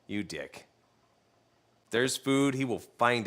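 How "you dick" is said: muttering to himself. Retold or imitated. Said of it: "I was just like".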